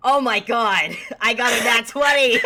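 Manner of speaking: loud cry of joy; thrilled. Real, not acted. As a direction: shout out the lucky roll. Rolls a natural twenty.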